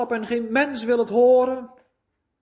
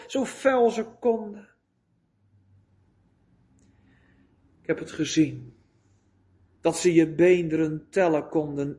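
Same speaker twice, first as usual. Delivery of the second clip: grieving father; 4 semitones lower than usual. Acted. El Shaddai mourning his son's suffering, low and grieving. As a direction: let each word sink, heavy with sorrow.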